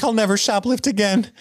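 high mock-desperate vow; squeaky high promise, hammed up; quoting her. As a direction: falsetto pledge to reform. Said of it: "And she's like".

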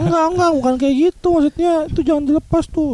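high-pitched worried mother; mom's voice. Retold or imitated. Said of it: "mak gua langsung".

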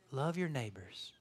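soft, slow, low monotone impression; gently hushed, slow impression of a meek teacher; Mister Rogers. Preacher mocking people's idea of Jesus, soft-spoken monotone. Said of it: "like, soft-spoken".

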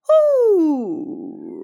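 high hoo dropping into vocal fry; gravelly hoo. Shown as a demonstration. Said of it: "you go".

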